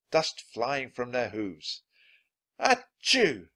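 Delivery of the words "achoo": high, loud mock sneeze; acted sneeze. A narrator's reading. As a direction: burst out with a spoken achoo. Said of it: "Jack sneezed".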